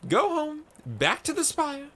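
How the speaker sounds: high, loud cry to go home. Acted. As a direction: call him home with a high ringing cry. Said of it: "cried Maiko".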